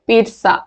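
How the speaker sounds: पिज्जा as "peet-sa"; a crisp "ts" in the middle of पिज्जा; correct pronunciation. Shown as a demonstration. Teacher repeating the right form, पिज्जा as "peet-sa". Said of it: "पिज्जा होता है".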